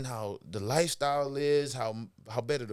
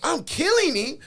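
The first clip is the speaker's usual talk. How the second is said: high, loud retold protest; incredulous self-talk; 9 dB louder, slower than usual. Acting out his own frustrated self-questioning.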